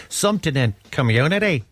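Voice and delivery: amateur go at a Northern Irish accent; "community" in put-on Northern Irish, middle vowel stretched to "ow". Shown as a demonstration. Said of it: "That's not bad, though, actually".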